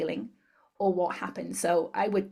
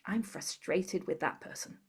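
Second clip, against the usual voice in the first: quiet tapping phrase; 7 dB quieter than usual. Shown as a demonstration. A therapist softly voicing the phrase while tapping. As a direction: murmur it as you tap. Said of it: "The next point is the bone".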